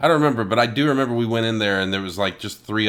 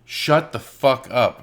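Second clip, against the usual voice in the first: slow, louder mock snarl; imitating a rude guy; 5 semitones higher, 6 dB louder, much slower than usual. Stranger in the theater snapping at them, slow mock anger. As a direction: spit it out slowly like an annoyed stranger. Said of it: "And he's like".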